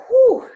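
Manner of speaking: a big, high, loud whew of dismay; exaggerated whew. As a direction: blow it out as if it's overwhelming. Describing her intense swelling.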